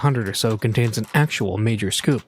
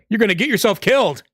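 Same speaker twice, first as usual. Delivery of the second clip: higher, louder warning shout; 10 semitones higher, 4 dB louder than usual. A narrator's reading. Driver shouting at a man in the street, loud warning. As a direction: yell out the window at a pedestrian. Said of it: "he shouted at someone".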